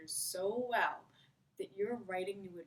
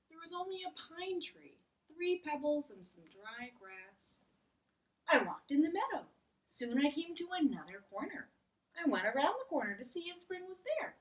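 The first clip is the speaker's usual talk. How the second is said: higher, earnest storyteller Frog; Frog's voice; 6 semitones higher than usual. Acted. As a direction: pitch up as Frog describing the meadow. Frog continues his story.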